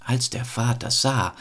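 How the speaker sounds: ordinary delivery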